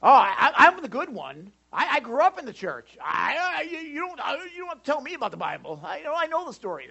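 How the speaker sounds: high, stammering mock indignation; mock defensive believer. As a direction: sputter it like an offended churchgoer. Mimicking defensive self-declared believer.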